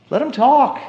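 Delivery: loud, high, insistent; loud, pleading emphasis; emphatic. Shown as a demonstration. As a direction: punch it out with force. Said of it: "Let 'em talk".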